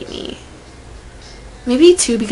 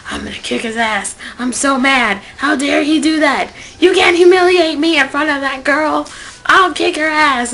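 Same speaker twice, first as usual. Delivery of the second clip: indignant rant building louder and higher; retold angry outburst; 5 semitones higher, 5 dB louder than usual. Retelling her rage after he humiliated her, angry. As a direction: fume louder and louder, re-enacting your own outrage. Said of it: "And I was so angry".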